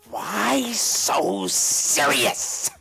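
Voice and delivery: very slow, drawn-out menacing question; imitating the father. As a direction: stretch every word like a threat. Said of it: "and he says".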